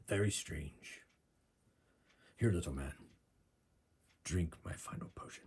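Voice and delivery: low, hushed fighter's voice; Puncher's character voice. Acted. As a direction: speak low and soft, in short phrases. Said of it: "Puncher says".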